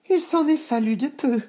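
put-on very high, relieved girl voice; high girl voice after running away; panting little girl. A narrator's reading. Boucle d'Or after fleeing the house, high relieved voice. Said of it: "dit-elle en haletant".

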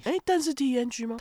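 high surprised question; startled disbelief. Real, not acted. Surprised at spelling.

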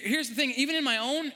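normal talking voice

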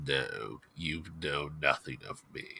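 low, quiet in-character denial; secretive Abner. Acted. Abner denying he knows the freed prisoner, low and quiet. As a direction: keep it down, low and guarded.

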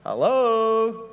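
drawn-out, sing-out hello; mock attention-getting. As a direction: call out to someone not paying attention. Angels hailing the oblivious disciples.

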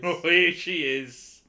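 high, loud, drawn-out greeting; slow, delighted exclamation; excited welcome. Real, not acted. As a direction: hail a long-awaited star with a laugh. Greeting Barbie's long-awaited appearance.

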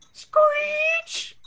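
high squealing screech; screech sound effect. Acted. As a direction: shriek it like skidding brakes. Reading sound effect aloud.

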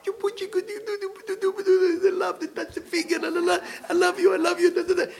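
high, sing-song cooing lovers voice; mock lovey-dovey couple. Teacher mocking a sweet couple to students, high cooing. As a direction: coo it like a syrupy couple in love.

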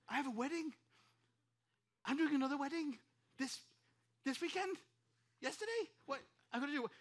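high, rising questions, broken by pauses; retold incredulous panic. Pastor learning of a forgotten wedding, acted-out disbelief.

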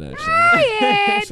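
yelled out high; sudden shout. Real, not acted. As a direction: burst out loud. Mock-offended dismissal of joke.

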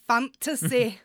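a loud, hammed-up Scottish "fantasy"; mock Scottish accent. Host tries an Irish accent, lands Scottish, loud. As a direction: roll out "fantasy" like a Scotsman. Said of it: "that's more Scottish".

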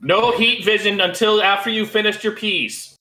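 high-pitched mock parental order; scolding parent. Parent to young Superman at dinner, hammed-up high scolding. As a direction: lay down the dinner-table rule, hammed up.

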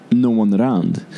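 ordinary talk, nothing marked

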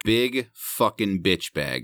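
ordinary delivery

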